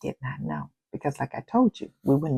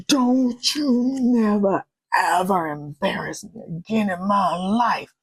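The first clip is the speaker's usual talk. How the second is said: slow, louder, emphatic scolding; mock angry outburst; 4 semitones higher, 3 dB louder, slower than usual. Wife warning her husband never to embarrass her, slow and louder. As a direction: drag out the warning, hard.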